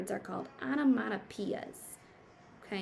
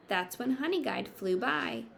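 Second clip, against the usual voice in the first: usual speaking voice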